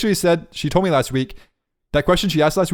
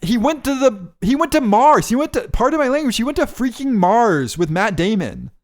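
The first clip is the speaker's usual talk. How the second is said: excited; 8 semitones higher than usual. Real, not acted. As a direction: pitch jumps high with excitement. Excited about the Nolan movie.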